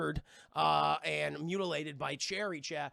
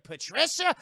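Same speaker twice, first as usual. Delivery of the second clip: confused grandfather; 8 semitones higher, 9 dB louder than usual. Retold or imitated. Grandfather mishearing the name.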